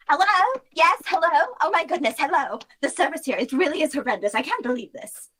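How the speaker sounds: high, shrill entitled customer calling out; high indignant complaint; impatient Celia. Acted. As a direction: ring for service in a high, indignant voice.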